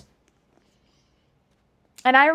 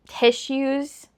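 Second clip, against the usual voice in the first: ordinary talk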